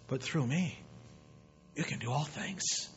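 very soft, gentle-volume answer; hushed divine reply. Acted. God answering the one who can't, hushed reply. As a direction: say it quietly, with a pause midway. God's reply, voiced softly.

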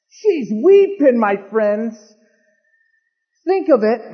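impassioned preacher's build; fervent sermon cry; preaching. Acted. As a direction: plead with the congregation, rising in pitch. Preaching at full force.

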